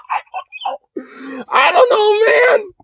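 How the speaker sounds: slow, high squeal through laughter; helpless with laughter. Real, not acted. Streamer baffled by potato head man, laughing squeal. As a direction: wail it out while cracking up.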